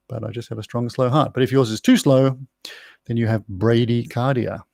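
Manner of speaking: ordinary talk, nothing marked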